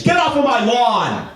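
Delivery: high, drawn-out mock yell; yelling at kids. Man continually yelling at kids, mock-angry holler. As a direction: holler like an acted cranky old neighbor. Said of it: "I'm continually yelling at kids".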